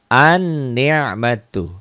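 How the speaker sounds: slow, stretched An-ni'matu with a held doubled n; drawn-out Arabic demo. Shown as a demonstration. Teacher models the word for pupils, slow and stretched. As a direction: sound out An-ni'matu slowly, lam silent. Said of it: "Itu alif lam yang tidak dibaca".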